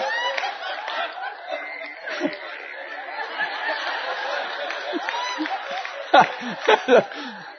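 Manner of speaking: a long, breathless, silent wheeze of laughter; long wheezing laugh. Real, not acted. Preacher cracking up at his own dial-up joke, wheezing laughter. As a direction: collapse into helpless wheezing laughter.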